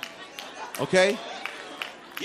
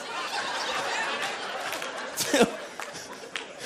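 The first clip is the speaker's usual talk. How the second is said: breathy silent laugh; 7 dB quieter than usual. Real, not acted. Laughing at his orcs joke.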